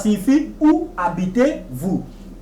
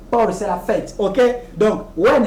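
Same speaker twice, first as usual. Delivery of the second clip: ordinary talk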